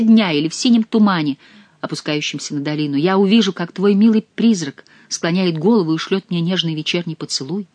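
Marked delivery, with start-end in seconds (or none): none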